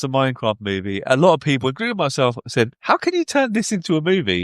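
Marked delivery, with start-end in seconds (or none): 2.8-4.4 high-pitched retold disbelief; incredulous, retold. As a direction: question it in mock bafflement. Quotes sceptical reaction to announcement.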